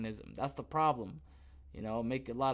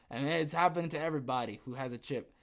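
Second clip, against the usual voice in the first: ordinary delivery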